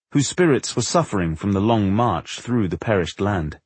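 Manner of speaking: ordinary delivery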